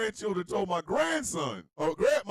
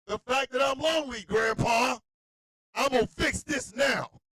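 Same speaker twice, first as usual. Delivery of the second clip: high, emphatic declaration; hammed-up toddler resolve; determined granddaughter; 6 semitones higher, 3 dB louder than usual. Acting determined granddaughter on phone.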